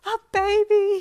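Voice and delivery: very high, very loud squeal; excited scream. A narrator's reading. Friend repeats his baby news, screaming. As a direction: scream it out with joy. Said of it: "he screamed".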